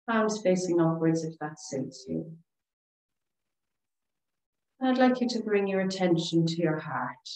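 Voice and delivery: calm, soothing relaxation voice with long pauses; guided meditation. Real, not acted. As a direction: guide them gently through each breath, leaving space. Leads breathing and meditation.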